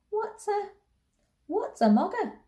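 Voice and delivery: put-on high-pitched, amused little-girl question; puzzled child. A narrator's reading. Girl asking the lady about an odd word, high and puzzled. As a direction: pipe up, baffled by the word. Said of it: "she asked".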